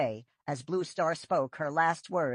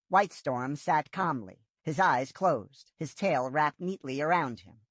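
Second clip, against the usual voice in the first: usual speaking voice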